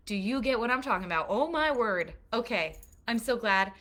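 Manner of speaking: thrilled the room is following along; excited. Real, not acted. Preacher thrilled her listeners are tracking, excited.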